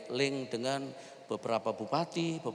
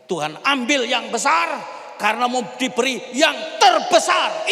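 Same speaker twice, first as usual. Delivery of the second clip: preach; 11 semitones higher, 15 dB louder than usual. Shown as a demonstration. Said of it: "Percayalah begini".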